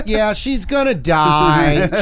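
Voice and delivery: slow, drawn-out mock old lady; imitating the caller. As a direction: stretch every word of the bad news. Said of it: "it was like".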